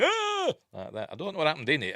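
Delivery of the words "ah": imitated scream. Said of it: "woke up in the nightmare going".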